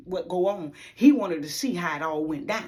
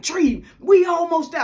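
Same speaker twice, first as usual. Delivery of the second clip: high, loud, eager push; pressing a friend to keep going; excited urging; 9 semitones higher, 7 dB louder than usual. Retold or imitated.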